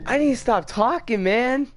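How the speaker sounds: high, loud exasperation; frustrated outburst. Real, not acted. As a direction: complain loudly, fed up with yourself. Frustrated after dying in game.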